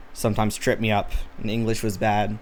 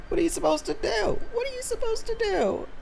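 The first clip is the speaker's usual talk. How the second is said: very high, squeaky mock plea; mock helplessness; 4 dB quieter than usual. Streamer commiserating over a chatter's misunderstanding, mock helplessness.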